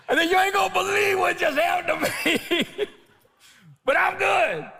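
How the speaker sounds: very high, loud laughing exclaim; preacher telling California, laughing and loud; laughing shout. Retold or imitated. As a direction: laugh it out with triumph. Laughing retold phone call.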